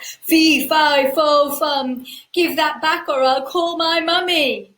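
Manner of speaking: loud, high booming giant roar. Acted. Giant demanding his goose back, loud roar. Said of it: "roared the giant".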